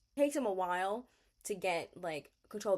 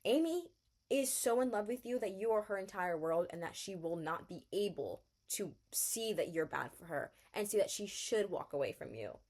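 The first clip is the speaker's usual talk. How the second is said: ordinary talk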